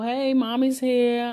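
high, slow mommy voice; acting out a singsong mother's hello; mommy greeting baby.

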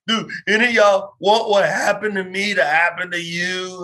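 preacher's loud, emotional altar call; retold blubbering plea. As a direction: call out to the kids, half in tears. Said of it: "And I was blubbering".

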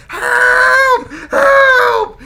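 very high, drawn-out scream; imitated woman's screams. Imitating the screams heard from the headset, high scream. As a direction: scream for help like a distant woman. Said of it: "it was screams of a woman".

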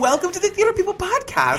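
high put-on woman voice; man doing a woman's voice. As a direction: welcome them in a high woman's voice. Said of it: "I'm gonna be in my woman voice".

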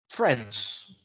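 ordinary talk

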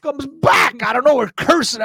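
high, loud, drawn-out exclamation; sudden shout. Retold or imitated. Telling how the man came back cursing, shouted. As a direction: burst out loud and slow for drama. Tells of sudden angry return.

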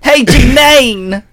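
long, loud, high call of the name; shouted greeting. Pretend. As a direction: belt it out like hailing across a room. Friends echo grandmother's name.

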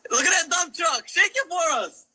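high whooping hype shout; excited shout. Real, not acted. Cheering a dancing streamer.

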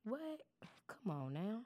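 a sudden breathy whispered what. Real, not acted. Host whispers to listeners in mock disbelief. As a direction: drop to a whisper, mock shocked. Stunned aside after old reference.

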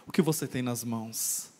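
a hint of a grave divine voice; voice of God. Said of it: "Deus falou assim".

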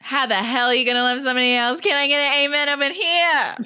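high, fast, loud preacher call; preachy catchphrase. Pretend. Hosts quoting a drag catchphrase, loud preachy call. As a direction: rouse the congregation.

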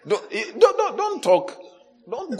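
high, playful don't-don't; laughing as you stop them; laughing hush. Real, not acted. Preacher hushing the crowd, laughing.

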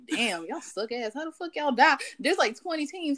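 rag on the players from behind; mock heckler talking trash at gamers. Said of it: "I'm like".

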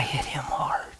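hushed whispered aside; soft whispered confession. Real, not acted. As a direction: whisper it in awe.

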